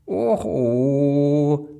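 hohes, spöttisches Hexen-Och; Hexenstimme. Acted. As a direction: gedehnter Seufzer einer alten Hexe. Hexe antwortet. (krächzende Hexe, höhnisch)

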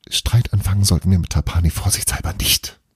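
usual speaking voice

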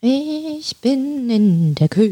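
distant, slow, calling from the kitchen; from another room. Real, not acted. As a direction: far off down the hall.

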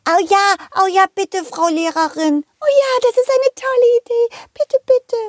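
put-on high, excited begging children; little ones pleading with delight; very high kid voices, thrilled; eager kids.

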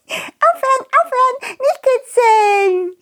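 high squeaky worm voice, giggling; being tickled. Acted. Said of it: "plötzlich kicherte jemand".

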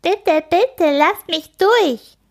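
taunting bully mimicking a crybaby; mocking imitation. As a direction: ape the girl to tease her. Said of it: "äfft Grit Kim nach".

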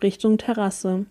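ordinary delivery, nothing marked

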